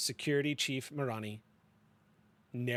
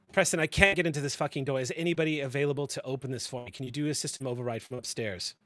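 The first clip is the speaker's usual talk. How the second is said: annoyed call; 4 semitones higher, 7 dB louder than usual. Acted. Calling up over comms angrily.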